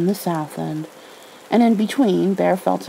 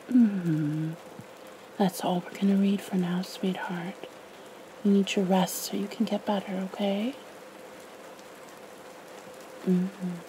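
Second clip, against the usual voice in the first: soft soothing; 10 dB quieter than usual. Real, not acted. Mother soothing sick child.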